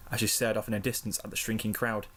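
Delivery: usual speaking voice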